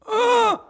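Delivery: very high mock horse grunt; strained squeal of a bucking horse. Preacher imitates the horse straining under Mike, high grunt. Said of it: "I watch this horse go".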